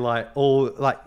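ordinary delivery

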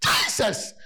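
a choked cry of Jesus with no voice in it; strangled cry. Retold or imitated. Believer in a nightmare calls on God, voice failing. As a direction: try to cry Jesus but it comes out breathless. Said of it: "You wanted to cry".

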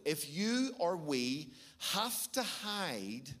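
slow, weighty preaching; solemn emphatic slow sermon; slow and deliberate. Real, not acted. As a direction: let each word land slowly. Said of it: "I want you to hear this truth very powerfully".

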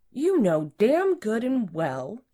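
high, loud, drawn-out indignation; angry rebuke. A narrator's reading. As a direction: lay into them, stretching each word. Boss angrily rebukes employee.

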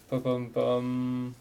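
slow hummed drumroll; drumroll sound. Pretend. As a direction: build suspense like a drumroll. Drumroll before naming a pick.

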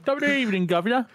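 high-pitched hammed-up British greeting; mock British accent. Co-host joins in the British greeting, hammed up. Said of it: "my best British accent".

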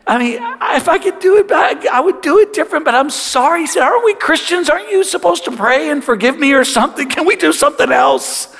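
high-pitched, frantic pleading; mock tearful kid voice; imitated bawling son. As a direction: beg your dad for mercy, hammed-up. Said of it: "He's just bawling".

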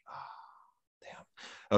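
breathy whispered "oh damn" under the breath; a hushed, voiceless curse to himself; whispered dismay. Retold or imitated. Realizing his knee is badly hurt, whispered curse.

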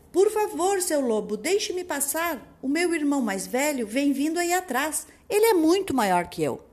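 high pleading little goat. Acted. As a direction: beg the wolf in a high voice. (cabritinho suplicando)

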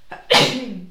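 second real sneeze; sneezing. Real, not acted. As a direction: sneeze again. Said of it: "Vou espirrar outra vez".

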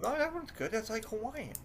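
a light mock-delighted wife; imitating delighted wife. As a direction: gush over the name like the wife did. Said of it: "and she goes".

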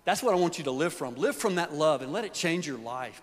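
ordinary talk

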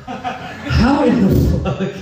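high-pitched, drawn-out exasperation; slow, high comic despair; exasperated. Acted. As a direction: drag it out in high disbelief. Acted exasperated plea about credit score.

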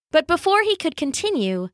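ordinary delivery, nothing marked

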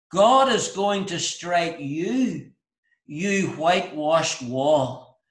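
slow, weighty condemnation; solemn rebuke. Retold or imitated. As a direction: lay each word down slowly, like a verdict. Paul rebukes the high priest.

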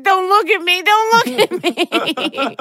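high, loud laughing plea. Retold or imitated. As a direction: beg through laughter. Ashamed plea to friend, laughing.

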